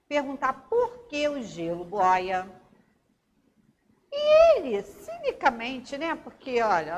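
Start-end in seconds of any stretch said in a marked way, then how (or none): 4.1-4.8 loud, high burst of indignation; indignant exclamation. Acted. Teacher reacting to the father's cheeky answer, loud and indignant. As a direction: exclaim as if scandalised. Mock outrage at the cynical answer.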